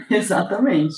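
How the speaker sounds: chuckling while saying it; laughing. Real, not acted. Guest laughing along at the host's joke, slowed laughing agreement.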